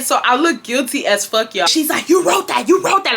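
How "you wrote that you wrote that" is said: high-pitched imitation of a screaming mom; hammed-up repeated accusation; mock screaming. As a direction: act out mom yelling it. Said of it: "she's screaming at me".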